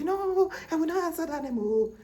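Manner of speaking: high, loud defiant no; defiant refusal. Retold or imitated. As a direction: refuse the name loudly, like the stubborn elders. People refusing the name, acted.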